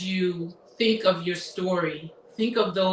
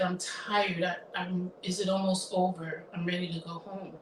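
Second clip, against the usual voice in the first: much softer, weary murmur; hushed complaint; 7 dB quieter than usual. Retold or imitated. Mother murmuring to daughter during service, hushed.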